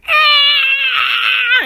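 high, loud screaming roar; monster roar. Retold or imitated. Godzilla screaming in King Kong's face, high loud roar. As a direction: let out a huge monster scream. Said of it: "Godzilla's like".

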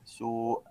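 سوء with the و held two beats; سوء sung out long; long vowel demo. Shown as a demonstration. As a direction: draw out the و in سوء. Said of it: "لازم تمد".

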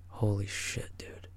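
hushed, stunned whisper; freaked-out whisper. Retold or imitated. Tripping friend to his buddy, imitated freaked-out whisper. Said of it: "I'm freaking the fuck out".

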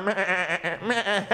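soft fake whimpering meh; mock sobbing over the dead wolf. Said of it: "sobbing and".

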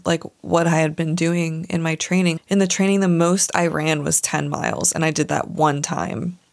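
ordinary talk, nothing marked

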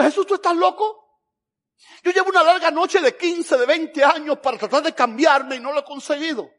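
loud, high-pitched objection; exasperated protest. Acted. Believer objecting to Jesus after years of trying, exasperated protest.

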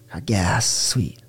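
soft unvoiced little cheer; whispered delight. Man showing his childlike joy over gas, whispered. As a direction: whisper it like a secret small win. Childlike stoked self-talk about car.

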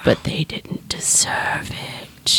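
slow, unvoiced resentful whisper; a hushed resentful aside to herself; whispered grumble. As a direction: mutter it under your breath, slowly. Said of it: "I'm like".

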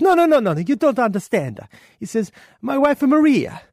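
put-on high, loud hammy Italian accent; excitable Italian voice, drawing out words, then shouting; Italian guy. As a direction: plead as an exasperated Italian father, building to a bellow. Punchline in the Italian father's voice.

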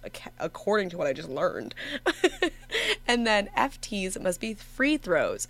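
normal talking voice

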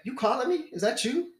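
high-pitched confused questions; puzzled questioning. Retold or imitated. Answering the stranger who called him, puzzled questioning. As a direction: act out checking who called.

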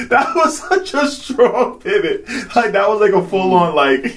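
high, loud laughing tease. Real, not acted. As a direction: tease him through laughter. Teasing while laughing.

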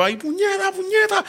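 high-pitched retold panic; panicked cursing. Stalled driver cursing amid honking horns, high mock panic. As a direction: curse in a high voice as the car stalls.

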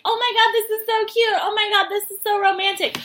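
high, gushing mock swoon; gushing fangirl. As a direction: coo over the cute couple. Beach crowd gushing over couple.

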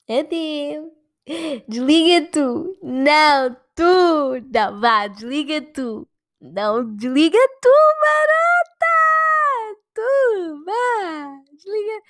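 playful lovers' hang-up banter; giggly late night call. Pretend. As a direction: play the "no, you hang up" couple. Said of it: "Estou a brincar".

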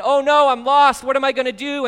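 put-on sudden panic of a child in a crowd; panicked lost child. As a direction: call out like a kid who just lost mom.

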